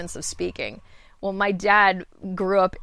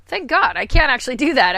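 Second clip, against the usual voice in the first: relieved exclamation; 5 semitones higher, 8 dB louder than usual. Retold or imitated. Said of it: "and be like".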